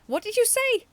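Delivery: high, loud shocked outburst; shocked exclamation. A narrator's reading. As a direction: blurt it out in shock. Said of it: "the Rubber Robo exclaimed, shocked".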